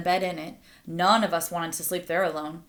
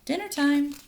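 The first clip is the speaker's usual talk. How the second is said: higher call across the yard; 7 semitones higher than usual. Acted. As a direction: summon everyone in to eat. Calling children to dinner.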